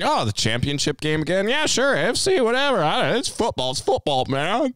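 high, careless dismissive voice; bored Gronk voice. Retold or imitated. Friend imagines Gronk shrugging off another title game, high careless voice. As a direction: shrug it all off in a high goofy voice. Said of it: "he's probably going".

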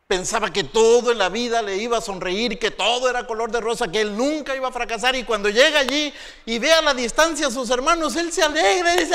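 high, loud preaching; full-force preaching. Acted. Preacher telling the church about Joseph. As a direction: drive the story home to the crowd.

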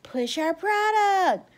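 high, drawn-out parody of influencers plugging; mock influencer pitch. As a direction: push it in a high, stretched-out mocking voice. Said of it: "sit up there and say".

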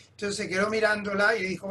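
usual speaking voice

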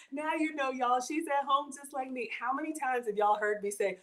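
ordinary talk

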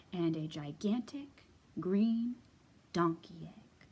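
usual speaking voice

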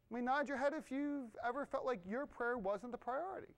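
ordinary talk

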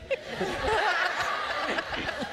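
high giggling fit; real burst of laughter. Real, not acted. Author cracks up reading her own line. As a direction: break up laughing mid-reading.

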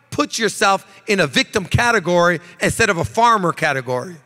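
ordinary talk

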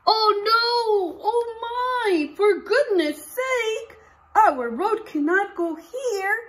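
high, loud cries of alarm; dismayed workers. Acted. Teacher reading the workers' panic to the class, high and loud. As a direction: read it as worried workers panicking.

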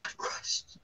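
whispered, barely audible; a real whispered confession of being crushed. Real, not acted. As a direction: breathe it out in a hush.

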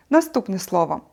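normal talking voice

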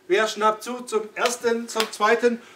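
loud auctioneer call, slowing on the count. Pretend. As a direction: call it out like a going-going-gone auction. Verkäufer ruft Preis aus. (lauter Auktionatorruf)

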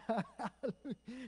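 delighted laugh mid-praise; joyful laugh. Real, not acted. Preacher loving it when God shakes the earth, laughing. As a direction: laugh out loud with joy.

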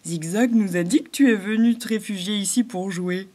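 a friendly animal asking; beaver's voice. A narrator's reading. Ragus the beaver asks the newcomer, lightly voiced.

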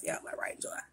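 a quick whispered aside; whispering. Real, not acted. Aside after announcing.

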